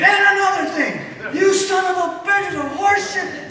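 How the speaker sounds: high, loud yell bursting back into the room; furious comeback shout. Retold or imitated. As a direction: storm back in, still raging. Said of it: "And here comes Jim Lee".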